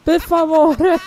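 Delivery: playful Italian "per favore"; hammed-up Italian begging; Italian accent. Co-player mimics Italian.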